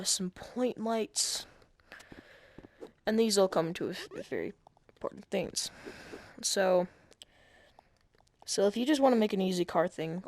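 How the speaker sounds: normal talking voice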